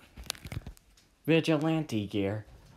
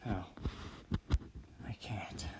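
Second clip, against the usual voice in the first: low, very soft admission; small, defeated murmur; downcast; 5 semitones lower, 11 dB quieter than usual. Acted. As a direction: sink down nearly to a whisper. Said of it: "looks down and takes a breath".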